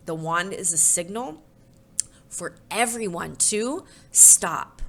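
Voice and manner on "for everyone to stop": plain reading voice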